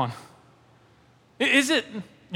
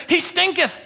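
put-on high, theatrical old-English delivery; preacher quoting King James in a put-on high voice; mock King James voice; 11 semitones higher than usual. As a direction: ham up the archaic line. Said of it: "The King James says".

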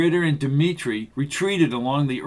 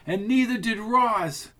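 yelling; 8 semitones higher than usual. A narrator's reading. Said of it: "Catherine yelled from below".

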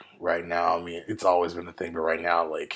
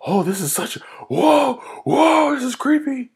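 high, loud freak-out, played up; mock alarm; 7 dB louder than usual. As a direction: imitate a viewer panicking, pitch leaping up. Acting out shocked first-time viewers.

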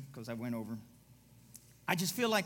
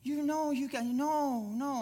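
flustered realtor; 8 semitones higher, 4 dB louder, slower than usual. Retold or imitated. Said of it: "He goes".

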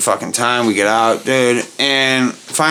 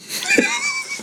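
high whiny squeal; 6 dB quieter than usual. Retold or imitated. Imitating drunk friend.

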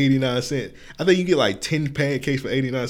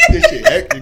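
laughing imitation; 9 dB louder than usual. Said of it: "He gonna bite it like".

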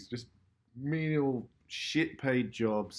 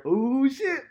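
much higher, loud shocked cry; startled exclamation; 9 dB louder than usual. Retold or imitated. Painters startled at the huge wall, loud shocked cry. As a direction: yelp it like you've been caught.

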